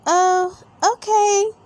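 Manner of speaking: put-on high, bright little girl agreeing; high piggy girl voice; Peppa voice. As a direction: Peppa giving in to Daddy, high girly voice.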